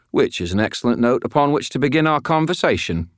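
a London Cockney geezer speaking; Cockney accent. A narrator's reading. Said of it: "with a heavy Cockney accent".

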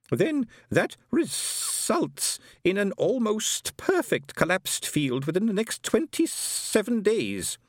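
high, very slow, drawn-out character voice; deliberating Herr Schugen figure. A narrator's reading. Herr Schugen working out the bank's equation, deliberating. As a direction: stretch out the conclusion high and slow.

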